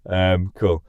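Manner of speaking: normal talking voice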